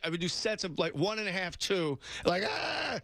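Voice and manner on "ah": high-pitched mock straining groan; strained effort cry. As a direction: imitate straining under a heavy bench press. Imitates straining on the bench press.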